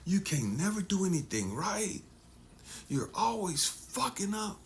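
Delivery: high, exasperated parental put-down; scolding parent. Retold or imitated. Parent telling the child they always mess up, high exasperated scold. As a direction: snap it at the kid like a fed-up parent, pitched high. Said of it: "they had all this stuff to say".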